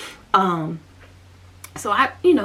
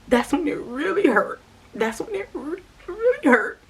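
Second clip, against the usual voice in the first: higher, strained voice breaking on the hurt; 6 semitones higher than usual. Real, not acted. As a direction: let the voice crack as the pain rises. Emotional peak recalling exclusion.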